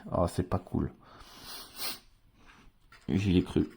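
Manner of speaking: ordinary talk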